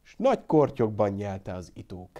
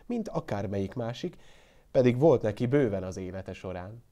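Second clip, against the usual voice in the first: ordinary delivery, nothing marked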